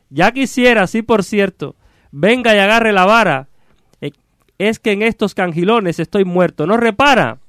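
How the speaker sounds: high-pitched, declaimed cursing in verse; theatrical exasperated ox-driver; irritated carter. Acted. Stuck carter cursing whoever called him, exasperated.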